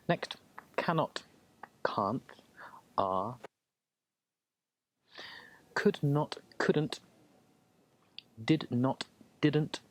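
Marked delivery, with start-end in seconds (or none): none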